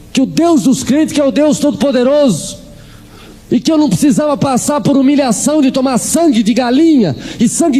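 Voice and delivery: mock cry out to God in her voice; mother crying out. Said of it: "E ela gritava".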